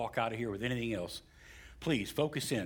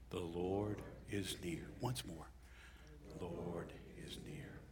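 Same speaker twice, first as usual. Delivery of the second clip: hushed affirmation; 5 semitones lower, 10 dB quieter than usual. Real, not acted. Preacher asking congregation once more, hushed fading affirmation. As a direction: repeat it softer, down to a whisper. Leading congregation in soft repetition.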